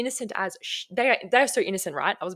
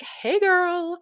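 much higher, louder bubbly greeting; gushing brand rep; 10 semitones higher, 5 dB louder than usual. Retold or imitated. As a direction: sell the friendly influencer pitch. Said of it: "they're like".

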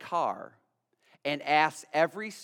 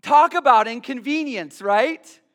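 loud, higher ironic jab; ironic punchline; 8 semitones higher, 12 dB louder than usual. Real, not acted. Pastor to congregation, ironic loud punchline. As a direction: land the irony hard.

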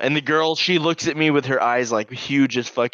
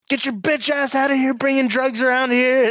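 high-pitched mock yell; angry dad; 13 semitones higher than usual. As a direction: imitate an enraged dad throwing someone out. Same dad ordering her out.

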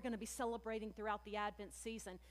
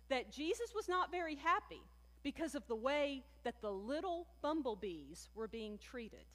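ordinary delivery

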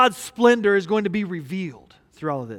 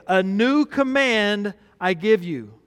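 weighty and slow, on new; scripture read slowly, new stressed; slow solemn reading; slower than usual. Shown as a demonstration. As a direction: read it deliberately, leaning on new. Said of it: "it starts this way".

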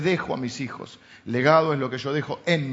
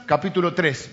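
ordinary delivery

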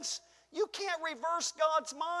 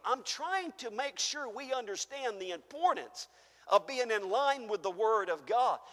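usual speaking voice

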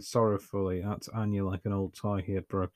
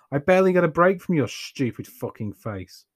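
angry; 7 semitones higher, 6 dB louder than usual. A narrator's reading. Anya furious at Keaton.

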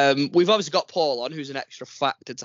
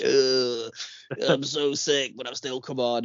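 hammed-up poorly sick voice; fake sick voice; slower than usual. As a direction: groan it out like you're ill. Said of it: "I've put my fake sick voice on again".

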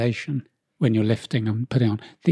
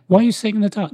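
mock nag, pitched high; mocking nagging voice; 9 semitones higher, faster than usual. Mimicking people who nag.

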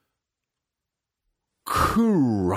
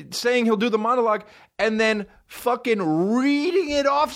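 higher, rising indignant rant; angry rant; 13 semitones higher than usual. Real, not acted. Comic fuming at the actor's audacity, high rant. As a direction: climb up in outrage. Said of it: "I'm also angry".

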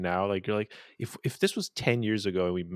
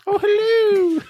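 very high, slow, laughing falsetto hello; high mock greeting; 7 dB louder, much slower than usual. To podcast hosts after Mrs Doubtfire comes up, a high laughing falsetto greeting. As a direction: coo it out like a sweet old nanny.

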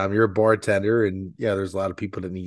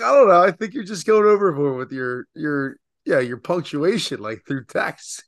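much higher and louder, hammed-up exasperation; mock worked-up lecture on punctuation; mock exasperated; 6 semitones higher, 5 dB louder than usual. Retold reply, getting worked up.

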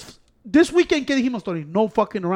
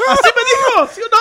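much higher and louder, laughing through it; excited high squeal; 6 dB louder than usual. Real, not acted. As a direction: squeal it out with glee.

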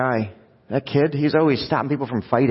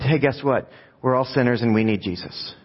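usual speaking voice

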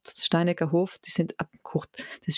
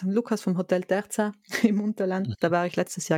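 ordinary talk, nothing marked

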